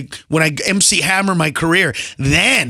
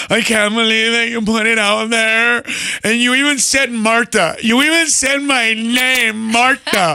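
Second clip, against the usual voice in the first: whiny put-on wail, words stretched; hurt sister; 3 semitones higher than usual. Sister calling the comic about his jokes, mock hurt. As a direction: imitate a wounded sister, dragging out the complaint. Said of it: "call me up all hurt, butt hurt".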